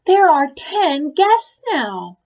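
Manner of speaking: slow, loud, exasperated. A narrator's reading. Ruby exasperated at the growing guest list, loud. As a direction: spell it out in frustration. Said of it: "said Ruby".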